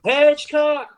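top-of-the-lungs scream of a name. Retold or imitated. Coach screaming a player's name across practice, full yell. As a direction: yell it across the field, high and loud. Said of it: "screaming this at the top of his lungs".

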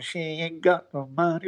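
singing through a held nose; nasal voice. Shown as a demonstration. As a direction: pinch the nose and sing it. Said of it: "I held my nose and".

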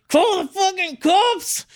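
high, slow, urgent; urgent aside. Retold or imitated. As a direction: hiss the aside slowly. Whispered aside to partner.

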